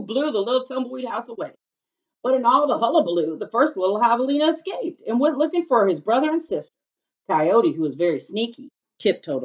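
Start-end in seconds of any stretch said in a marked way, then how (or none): 2.2-6.7 excited narration. A narrator's reading.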